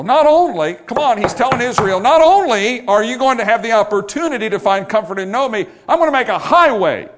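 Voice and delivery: high, loud pulpit proclamation; full-force preaching. Acted. Preacher proclaiming God's promise to Israel, full-force preaching.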